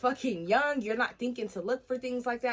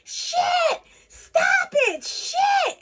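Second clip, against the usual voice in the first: very high, loud shrieked outbursts; hollering; 9 dB louder, much slower than usual. Retold or imitated. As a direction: imitate a hollering child, full volume. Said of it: "My baby's hollering".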